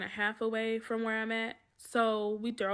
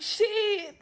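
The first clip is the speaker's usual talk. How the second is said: heated retort; 9 semitones higher than usual. Retold or imitated. To the accuser, a higher heated sassy comeback. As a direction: fire back at her.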